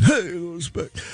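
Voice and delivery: lightly voiced aggrieved comic, a touch strained; Rodney Dangerfield impression. Host repeating the Dangerfield line to the studio. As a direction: play the put-upon stand-up. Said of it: "Rodney Dangerfield stopped in".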